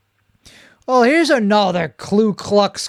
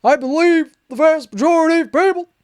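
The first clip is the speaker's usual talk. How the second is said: high-pitched, loud, fast caricature voice; put-on high voice ridiculing a public speaker; mocking impersonation; 10 semitones higher, 3 dB louder, faster than usual. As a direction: read it as an exaggerated, much higher mock voice. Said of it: "I gotta read in his voice".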